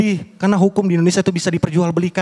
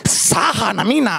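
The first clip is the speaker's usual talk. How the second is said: slow, spooky possessed voice; seance medium; slower than usual. Retold or imitated. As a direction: speak slowly like a spirit talking through someone. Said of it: "pura-pura kesurupan".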